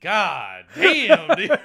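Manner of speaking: loud, high exclamation; hammed-up shock; mock stunned reaction. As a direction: burst out as if just roasted. Band reacts to [name]'s put-down.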